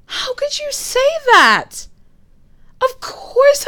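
high, slow, wounded cry of outrage; hurt outburst. Acted. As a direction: let the hurt break out loud. Hurt partner argues back.

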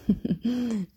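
a short, pleased evil laugh; gloating laugh. Acted. Goddess gloats over her prey.

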